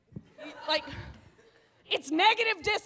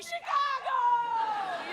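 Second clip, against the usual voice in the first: long, drawn-out, higher ecstatic cry; climactic shout; 5 semitones higher, much slower than usual. Retold or imitated. Friend climaxing with a skyline view, drawn-out ecstatic cry. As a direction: stretch it out like a climax.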